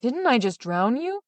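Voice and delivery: slow, stunned disbelief; Big Claus meeting Little Claus alive, slow astonishment; astonished. A narrator's reading. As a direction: drag it out in disbelief.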